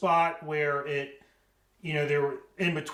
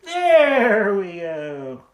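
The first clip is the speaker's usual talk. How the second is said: satisfied; 5 semitones higher, 13 dB louder, much slower than usual. Real, not acted. Frame finally closes up.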